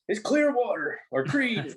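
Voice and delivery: high, slow mock dad answer; imitating dad chiming in. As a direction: chime in like dad at trivia.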